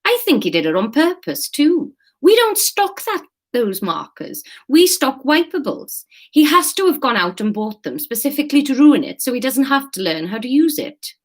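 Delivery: high-pitched indignant complaint; exasperated staff member. Acted. Staff member accuses colleague of sabotage, exasperated. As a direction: build the case with rising exasperation.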